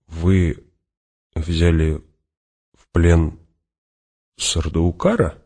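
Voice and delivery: word by word, with long pauses; slow disbelief. A narrator's reading. Adjutant cannot believe they took a prisoner, slow disbelief. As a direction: halt after each word, unable to believe it. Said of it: "медленно переспросил, не веря своим ушам".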